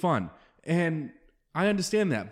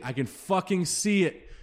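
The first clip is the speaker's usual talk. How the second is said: usual speaking voice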